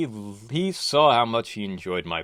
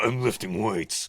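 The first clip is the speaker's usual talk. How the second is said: strained gym voice. Acted. Kaiser voice while lifting.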